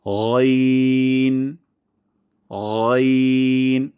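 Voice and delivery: loud, long গইন with thick throat sound; heavy ghain. Shown as a demonstration. Teacher modelling correct letter, long heavy গইন. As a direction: hold গইন, gh rasped at the upper throat. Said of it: "মোটা সাউন্ডে উচ্চারণ করব".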